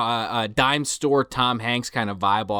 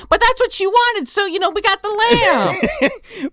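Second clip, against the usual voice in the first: much higher, louder rambling narrator; high mock narrator voice; 4 dB louder than usual. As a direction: squeak out the tale as the mad narrator. Mock straitjacket narrator continues.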